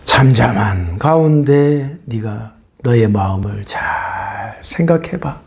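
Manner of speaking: slow right down, let it settle; calm. Acted. Calm reflection phrase.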